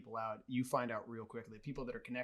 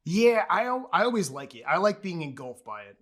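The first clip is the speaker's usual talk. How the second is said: excited; 6 semitones higher, 13 dB louder than usual. Real, not acted. Eager agreement, jumping in.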